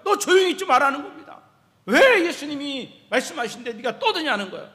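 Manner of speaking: loud, high mock telling-off; imitated scolding. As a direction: scold him like an annoyed crowd. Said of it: "꾸짖고 나무라는 거예요".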